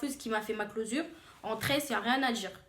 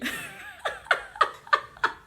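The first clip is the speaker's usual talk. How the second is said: high, loud peals of laughter; laughing fit; 5 dB louder than usual. Real, not acted. Recalling her reflection to the girls, laughing helplessly. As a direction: laugh hard and high, unable to stop. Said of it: "Là, je rigole".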